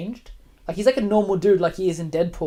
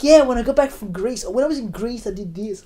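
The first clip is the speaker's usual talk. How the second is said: mock customer voice; 5 semitones higher, 6 dB louder than usual. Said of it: "customers are talking to me like".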